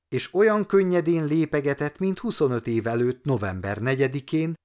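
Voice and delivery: plain reading voice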